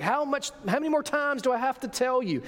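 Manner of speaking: put-on a tired parent scolding the kids again; exasperated parent. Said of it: "like an exasperated parent".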